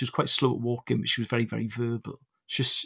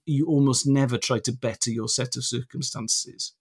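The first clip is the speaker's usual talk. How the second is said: ordinary talk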